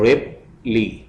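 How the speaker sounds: Ripley as रिप, pause, ली; split into syllables. Shown as a demonstration. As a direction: break Ripley into two separate beats. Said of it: "syllables में divide करना है".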